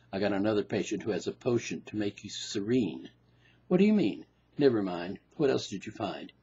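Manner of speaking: the analyst brushing off his patient; low deadpan shrink; weary doctor. Acted.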